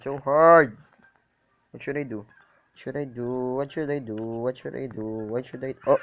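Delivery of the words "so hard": loud exasperated cry; frustrated shout. Real, not acted. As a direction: yell it out in frustration. Frustrated gamer yells.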